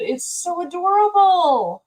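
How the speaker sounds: gushing. Real, not acted. Gushing over the fire pit.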